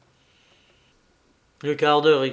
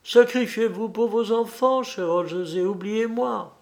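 high-pitched wife's voice; wife nobly pleading, pitched high; tearful wife; 6 semitones higher than usual. A narrator's reading. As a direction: plead in a raised, feminine character voice. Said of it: "s'écria madame Hodges en essuyant ses yeux".

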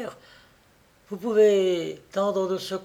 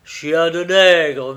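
contemptuous; 9 dB louder, much slower than usual. A narrator's reading. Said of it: "murmura Fleurimond avec mépris".

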